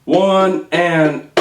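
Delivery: drawn-out One and a; counting a slow triplet. Shown as a demonstration. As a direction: stretch each subcount evenly. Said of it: "how to count it using the subcounts".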